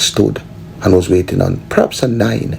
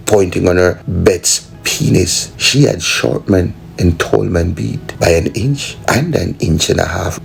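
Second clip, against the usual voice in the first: ordinary delivery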